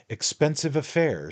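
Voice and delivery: a lightly voiced rake standing his ground; a hint of a cocky adventurer; Jingle, defiant. Jingle speaks in the story.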